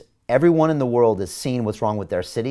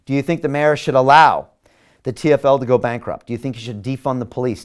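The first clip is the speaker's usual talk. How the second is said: ordinary delivery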